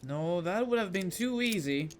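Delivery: usual speaking voice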